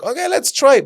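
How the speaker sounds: high, upbeat let's go; chirpy and willing; upbeat Ramon. Retold or imitated. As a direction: Ramon cheerfully trying the card again, high. Ramon quoted.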